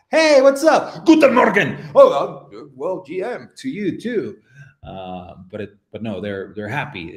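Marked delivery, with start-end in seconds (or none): 0.1-1.8 high, loud, harsh-sounding greeting; mock mad German. Streamer imitating a German greeting someone, mock mad shout. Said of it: "it's like you're mad". 1.9-4.4 startled loud start, then a hesitant reply; acted flustered response to being yelled at; mock taken aback. Someone answering a shouted German greeting, mock taken aback.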